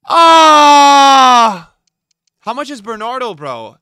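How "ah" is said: loud raw yell of frustration; frustrated scream. Real, not acted. Streamer screams at his squad builder in pure frustration. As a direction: let out a real angry scream. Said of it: "Why do you scream?".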